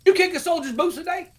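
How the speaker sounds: high and loud in disbelief; incredulous boss. Retold or imitated. Sergeant major confronting the first sergeant in his office, high and loud. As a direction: demand an answer, shocked.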